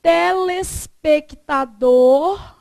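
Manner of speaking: "Telespectador" slow and stretched, rising at the end; slow demonstration. Shown as a demonstration. Teacher writing word variants, "Telespectador" slowly stretched. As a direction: draw out "Telespectador" syllable by syllable.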